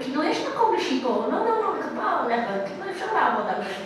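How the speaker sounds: fast, breezy Hebrew patter; rapid Hebrew. Retold or imitated. Tourist guide correcting the officer, rapid Hebrew. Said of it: "in flawless Hebrew".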